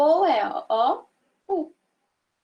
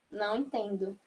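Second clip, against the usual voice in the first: soft not understanding; 4 semitones lower, 8 dB quieter than usual. Shown as a demonstration. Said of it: "você".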